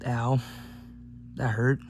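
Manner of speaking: usual speaking voice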